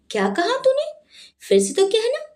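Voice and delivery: high-pitched, outraged challenge; enraged. A narrator's reading. Parul daring the neighbour to repeat it, enraged. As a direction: dare her to repeat it. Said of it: "ये सुनकर पारुल बिफर गई".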